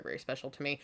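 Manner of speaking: ordinary talk, nothing marked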